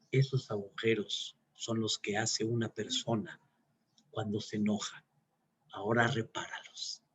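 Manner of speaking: soft, grave father's verdict; quiet solemn lesson. Retold or imitated. Father quietly showing his son the damage.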